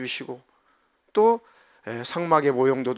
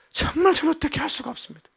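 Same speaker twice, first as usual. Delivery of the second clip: anguished plea; 13 semitones higher, faster than usual. Acted. Moses despairs to God.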